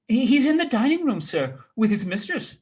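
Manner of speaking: high, fast, loud nervous reply; startled servant girl answering; flustered maid. Acted. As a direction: blurt it out in a fluster.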